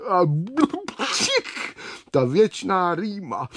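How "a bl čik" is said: bubbly build-up into a high sneeze; sneezing. A narrator's reading. Water scorpion with a constant cold, sneezing. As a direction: burst into a squeaky acted sneeze. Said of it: "A znovu mocně kýchla".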